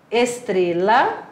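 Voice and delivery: ordinary talk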